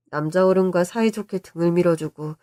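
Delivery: usual speaking voice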